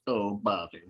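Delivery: low, droopy mopey voice; droopy dog voice. Acted. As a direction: sad sack cartoon dog. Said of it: "Like a droopy dog voice".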